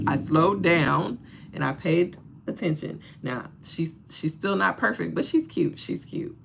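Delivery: ordinary talk, nothing marked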